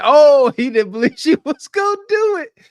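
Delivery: loud imitation of the man; imitating his loud voice. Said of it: "he said".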